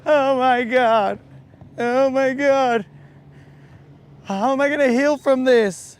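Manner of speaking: hammed-up tearful whine; mock crying. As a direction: mimic a crybaby wailing. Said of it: "with tears, you're gonna get on here and be like".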